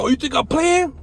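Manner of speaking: high, loud taunting dare; defiant challenge. Retold or imitated. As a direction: throw it at them like a threat. High retold challenge to guards.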